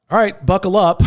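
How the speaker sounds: high, loud warning call; bracing announcement. Retold or imitated. Man about to share his story, loud bracing announcement. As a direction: announce it big, readying the room.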